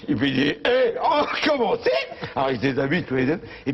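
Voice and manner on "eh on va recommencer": put-on excited old man. Excited husband.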